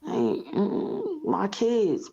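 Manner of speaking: real tears catch the words; crying. Real, not acted. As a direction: voice breaking into tears, slow and high. Breaks down over leaving kids.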